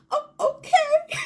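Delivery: high, loud baffled okay; bewildered okay. Retold or imitated. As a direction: caught completely off guard. Bewildered reply.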